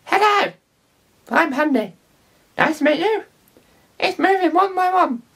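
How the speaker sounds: very high, squeaky puppet voice; Handy squeaky voice. Pretend. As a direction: push the puppet voice way up into a tiny squeak. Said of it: "this time, like this".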